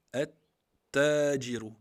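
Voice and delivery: at-tajiru, lam dropped, the t held long; Arabic demo word. Shown as a demonstration. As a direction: drag out at-tajiru, leaning on the doubled t. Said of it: "on rentre dans la shadda directement". (at-tajiru, lentement, shadda bien marquée)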